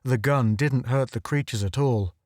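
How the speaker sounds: plain reading voice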